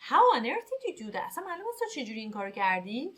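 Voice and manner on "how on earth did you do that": higher, louder, amazed question; astonished. Shown as a demonstration. Teacher demoing amazement at someone's feat. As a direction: blurt it out in disbelief. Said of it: "خیلی تعجب کرده".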